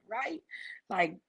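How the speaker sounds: usual speaking voice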